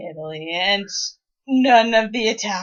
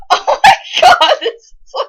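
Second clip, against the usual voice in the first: very high, loud laughing exclamation; 12 semitones higher, 10 dB louder than usual. Real, not acted. Co-host cracking up at the writing, laughing. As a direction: shriek it through laughter.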